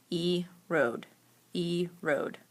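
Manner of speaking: erode broken into e and rode; split by syllable. Shown as a demonstration. Teacher demonstrating syllable breaks, erode split in two. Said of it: "break the word into chunks".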